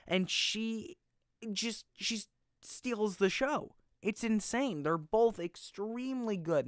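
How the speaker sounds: high, drawn-out rave about her; amazed praise. Real, not acted. Praising the actress to the viewer, slow and high in awe. Raving about [name]'s performance.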